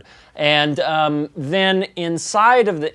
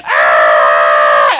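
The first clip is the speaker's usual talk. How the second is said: loud frightened cry of the crowd; crowd scream; 8 dB louder than usual. Acted. GM voices townsfolk screaming in fright. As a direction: scream and flee.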